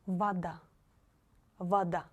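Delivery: вода said "va-DA". Shown as a demonstration. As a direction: model вода with "ah" for the unstressed O. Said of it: "you should read it like A".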